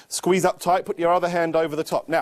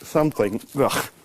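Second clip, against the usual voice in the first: mumbling through a mouthful of paper; muffled clown chewing paper; mouth full of paper; 14 semitones higher, much slower than usual. Shown as a demonstration. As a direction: chew and hum with your mouth stuffed like a clown. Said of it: "join the pieces back together in his mouth".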